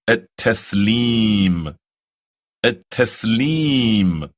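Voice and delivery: at-taslim slow, doubled t held; careful pronunciation. Shown as a demonstration. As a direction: spell out at-taslim slowly. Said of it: "The final pillar of Salah".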